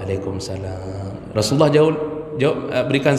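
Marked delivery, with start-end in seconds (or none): 0.0-1.3 soft, slow reply from inside the house; small voice. Retold or imitated. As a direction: answer the greeting barely aloud. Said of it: "kecil suaranya". (menjawab salam dengan suara kecil pelan)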